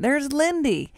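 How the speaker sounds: put-on high-pitched child pointing someone out; retold child's high voice; little daughter. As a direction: imitate a small girl's discovery. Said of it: "and she goes".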